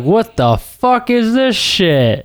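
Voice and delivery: slow, high disgusted outburst. Retold or imitated. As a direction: recoil at the taste, drawn out. Retold disgusted reaction to IPA.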